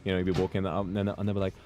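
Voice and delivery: ordinary talk, nothing marked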